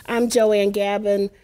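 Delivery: ordinary delivery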